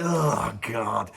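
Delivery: low, sinking mock groan; weary dread. YouTuber facing another video like this, mock weary dread. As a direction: let it drop away in resignation.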